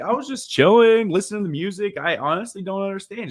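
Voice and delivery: playing dumb. Shown as a demonstration. Lawyer showing how to answer a traffic cop, playing dumb. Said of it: "Just play dumb".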